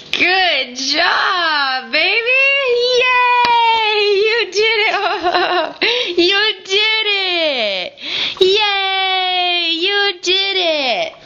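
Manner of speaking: tearful, slow happy praise; sobbing proud mother, slow; crying with joy. Real, not acted. Baby walks, mother cries happily.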